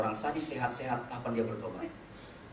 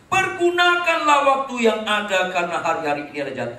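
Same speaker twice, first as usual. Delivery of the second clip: scripture reading; 8 semitones higher, 12 dB louder than usual. Acted. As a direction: read the verse like a proclamation. (membacakan ayat dengan lantang)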